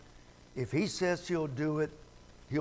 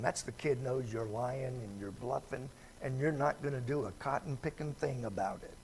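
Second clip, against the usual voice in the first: normal talking voice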